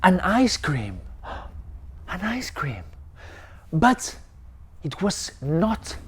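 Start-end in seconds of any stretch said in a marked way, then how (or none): 1.2-2.8 hushed, amazed whisper after a gasp; gasp of wonder. Acted. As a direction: breathe it out in awe. Ben discovers an ice cream.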